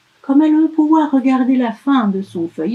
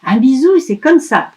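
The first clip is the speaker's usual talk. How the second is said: put-on higher, louder child voice; elf showing; 4 semitones higher, 6 dB louder than usual. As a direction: show it proudly like a child. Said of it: "fait le lutin".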